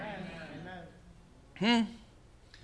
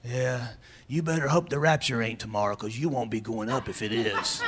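low taunting devil voice; devil's taunt; 7 semitones lower, 5 dB quieter than usual. Retold or imitated. Preacher voicing the devil whispering lies on your shoulder, low taunt.

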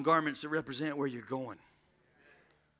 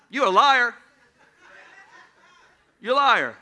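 playful call-out; 6 semitones higher, 11 dB louder than usual. Real, not acted. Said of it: "pastor retorts".

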